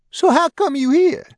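a frightened man's voice jumping up high; squeaky high panicked question; voice shooting up. Acted. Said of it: "voice shot an octave higher".

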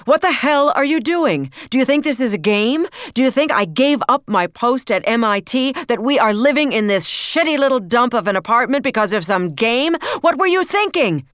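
high, loud raging rant; a father screaming at his daughter; furious tirade. A narrator's reading.

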